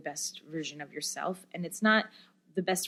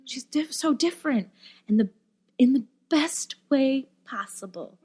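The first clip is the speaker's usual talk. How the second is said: higher voice, imitating amazement; ecstatic mother; 7 semitones higher, 3 dB louder than usual. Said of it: "she was just ecstatic".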